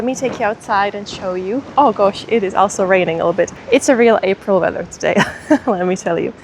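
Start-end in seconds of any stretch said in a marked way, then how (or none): none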